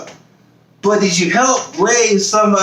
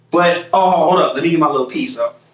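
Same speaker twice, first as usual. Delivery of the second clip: ordinary talk, nothing marked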